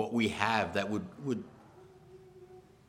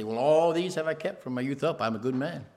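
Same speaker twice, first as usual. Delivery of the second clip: higher, smug bragging; 7 semitones higher, 4 dB louder than usual. Acted. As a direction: show off your good record to Jesus. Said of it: "to brag about his life".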